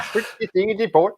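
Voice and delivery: high goofy mock-Swedish gibberish; Swedish Chef voice. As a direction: bork like the Muppet chef. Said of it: "like, Swedish Chef".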